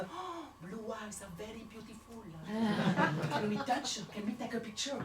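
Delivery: put-on lightly accented tourist English; mock tourist accent; Chinese tourists. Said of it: "ils me disent".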